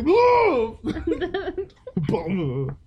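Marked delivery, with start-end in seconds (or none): 0.0-0.8 high, loud mock yelp; startled whoa. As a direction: yelp as if thrown off. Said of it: "they're just like".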